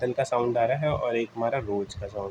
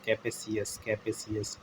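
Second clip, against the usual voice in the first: quiet drill repeat; 8 dB quieter, slower than usual. Shown as a demonstration. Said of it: "एक cap और एक आ रहा होगा सास".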